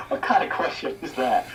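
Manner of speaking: words spilling through a laugh; amused disbelief, still laughing. Real, not acted. Guest answering host's accent question while laughing.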